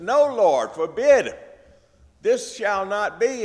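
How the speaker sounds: loud, high, pleading No Lord; alarmed protest. Retold or imitated. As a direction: rush in to stop him, alarmed. Peter jumps in protesting.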